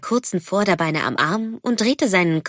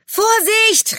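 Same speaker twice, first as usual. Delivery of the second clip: high, urgent call of warning; warning shout; 4 dB louder than usual. A narrator's reading. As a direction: shout a sudden warning, pitched right up. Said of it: "rief jemand".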